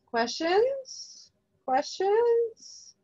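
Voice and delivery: high, rising playful call to the audience; calling for questions. Real, not acted. Host calling to a silent webinar audience, high playful rising call. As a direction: coax the silent crowd with a sing-song "questions?".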